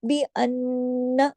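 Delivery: अन्ना with a long hummed न्न; slow बी अन्ना, hold न्न in the nose; nasal hold. Shown as a demonstration. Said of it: "दोबारा यहाँ पे गुन्ना होगा". (बी अन्ना, गुन्ने के साथ)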